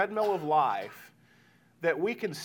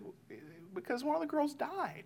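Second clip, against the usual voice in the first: put-on high, soft little-girl voice; imitated young child; 10 semitones higher, 10 dB quieter than usual. Child explains to parent why it was sad, imitated child voice. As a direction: imitate a small child quietly giving sad news.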